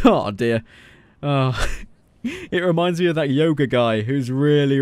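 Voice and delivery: ordinary talk, nothing marked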